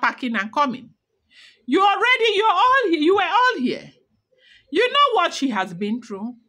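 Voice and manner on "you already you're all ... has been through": exasperated scolding. Real, not acted. Scolding the elders directly.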